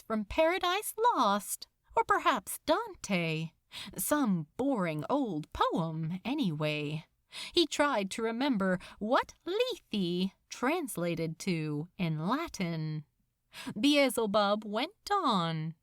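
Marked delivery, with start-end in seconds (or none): none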